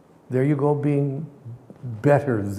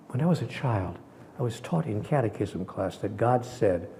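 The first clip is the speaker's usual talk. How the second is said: ordinary delivery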